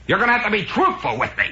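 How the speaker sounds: mock angry yell, pitched high; Casey. TV doctor demanding the truth from a patient, mock yelling. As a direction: lay down the law as a TV doctor. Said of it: "Casey's always yelling at people".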